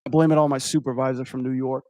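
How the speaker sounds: normal talking voice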